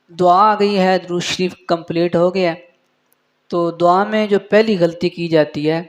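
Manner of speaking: ordinary talk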